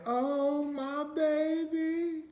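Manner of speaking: put-on very high, long drawn-out "baby"; grandma's loving cry. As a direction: coo it like a grandma welcoming her grandchild. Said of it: "she'd be like".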